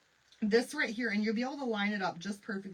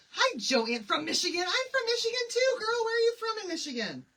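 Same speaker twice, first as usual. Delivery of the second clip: much higher, loud, thrilled hello; excited greeting; 14 semitones higher, 7 dB louder than usual. Real, not acted. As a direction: squeal a greeting to a neighbor. Excited greeting to a fellow Michigander.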